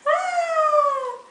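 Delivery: sudden high yelp; short excited squeak; high squeal. Real, not acted. Owner squealing at her dog, high yelp.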